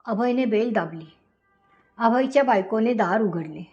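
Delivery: plain reading voice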